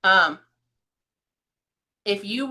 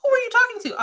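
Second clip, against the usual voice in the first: very high, louder incredulous question; mock astonishment; 6 dB louder, faster than usual. Coworkers overhear the phone call, high mock astonishment. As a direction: squeak it out, baffled by what you overheard.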